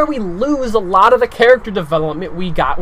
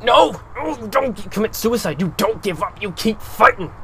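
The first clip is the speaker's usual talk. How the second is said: pleading outburst; 4 semitones higher than usual. Acted. Character pleading against suicide.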